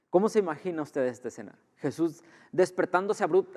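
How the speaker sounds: ordinary delivery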